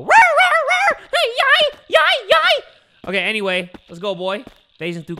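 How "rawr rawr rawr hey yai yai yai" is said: high, loud yelping rawr cries; mock scared yelps. Fleeing the dog, he mimics his own panicked yelps. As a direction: yelp like a spooked animal, hammed up. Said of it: "just go".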